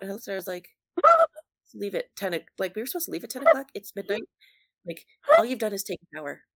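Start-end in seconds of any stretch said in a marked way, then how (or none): 1.7-6.4 louder and faster, higher exasperated rant; retelling the frustration, fast and loud. Retold or imitated. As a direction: vent at her for being late. Retold exasperated reply to friend.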